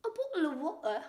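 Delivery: high-pitched exaggerated British, T dropped in "water"; British accent. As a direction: put on a cartoonish British accent. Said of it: "She nailed the British accent".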